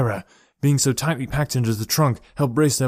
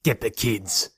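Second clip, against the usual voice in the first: snarl; slower than usual. A narrator's reading. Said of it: "he snarled".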